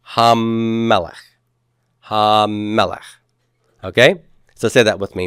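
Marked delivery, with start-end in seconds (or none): 0.1-1.1 Hamelech with a long, held m; doubled consonant. Shown as a demonstration. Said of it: "double that consonant's pronunciation". 2.0-3.2 Hamelech with a long, held m; doubled consonant. Shown as a demonstration. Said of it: "double that consonant's pronunciation".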